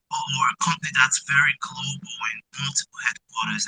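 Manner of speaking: ordinary talk, nothing marked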